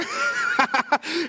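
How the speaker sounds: high-pitched laugh. Real, not acted. Laughs at his child's reaction.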